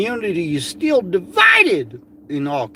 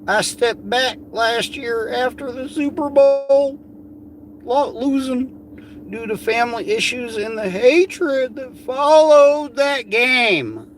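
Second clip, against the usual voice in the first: high put-on woman's voice; mocking Amy voice; 8 semitones higher than usual. Mocking her post in a high put-on voice. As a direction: read her words up high and sour.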